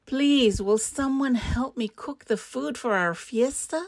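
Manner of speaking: high, loud plea on please; begging. A narrator's reading. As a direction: plead for someone to help. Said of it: "Iguana begged".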